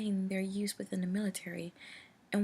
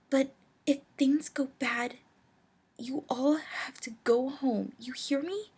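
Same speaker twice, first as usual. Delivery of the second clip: urgent; 5 semitones higher, 6 dB louder than usual. Acted. Cadance urgent, serious warning.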